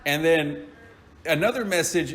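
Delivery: ordinary talk, nothing marked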